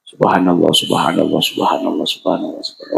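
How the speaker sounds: fading dhikr. Shown as a demonstration. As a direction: let the chant fade to nothing. (zikir makin pelan menghilang)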